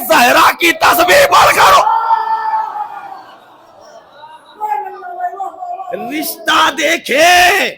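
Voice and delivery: high, loud and slow climax; impassioned preaching. Acted. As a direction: drive the point home, shouting. (پرجوش بلند خطابت)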